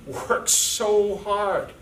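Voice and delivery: proud teacher stretching out worked so hard; stress on hard. Real, not acted. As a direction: lean hard on every word of worked so hard. Stressing Frank's effort.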